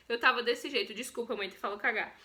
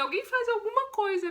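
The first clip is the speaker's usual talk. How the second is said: higher, louder desperate call; frantic plea; 8 semitones higher, 4 dB louder than usual. Retold or imitated. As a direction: beg anyone to act. Screaming at hospital. (súplica desesperada)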